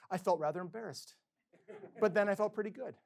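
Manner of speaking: a lightly voiced elderly musician; old man's quote. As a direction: lift it up as his proud reply.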